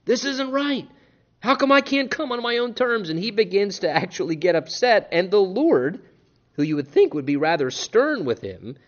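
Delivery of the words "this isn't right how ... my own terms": mock angry Cain. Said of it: "He's mad".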